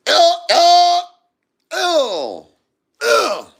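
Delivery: high, loud mock wails; very high squealed ohs; imitated answering cries. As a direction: wail back in short falling cries.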